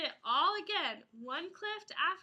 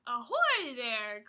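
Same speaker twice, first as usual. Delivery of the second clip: lower, louder, slow pirate hail; pirate call; 5 semitones lower, 5 dB louder, slower than usual. Acted. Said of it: "called a voice".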